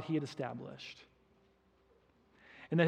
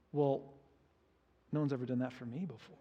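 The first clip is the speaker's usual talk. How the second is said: sulky mutter; 5 semitones lower, 9 dB quieter, faster than usual. Retold or imitated. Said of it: "he says".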